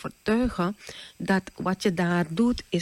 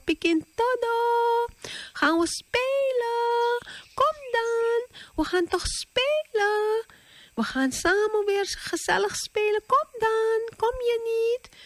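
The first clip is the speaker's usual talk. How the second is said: put-on sweet calling child. Pikkie and Snikkie calling Todo to come play, high sweet singsong.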